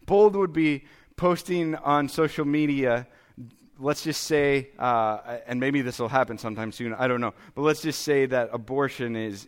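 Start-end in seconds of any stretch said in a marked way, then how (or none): none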